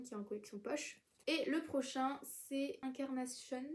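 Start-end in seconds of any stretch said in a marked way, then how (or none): 2.8-3.6 French title slipped into an English accent. Real, not acted. Book vlogger naming a title, accidental English accent. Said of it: "l'accent anglais".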